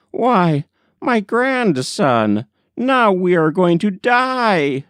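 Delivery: put-on frightened old woman. Grandmother telling her grandson they will die, high and afraid. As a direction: quaver it up high, sure of death.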